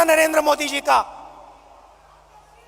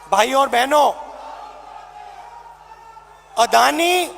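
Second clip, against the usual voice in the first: booming political rally speech. Real, not acted. Rally crowd address, shouting.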